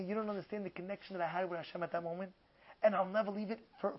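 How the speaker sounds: low, very soft retold confession; hushed, intimate quote; hushed testimony. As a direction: confide it quietly, as the rabbi remembering. Retells rabbi's intimate testimony.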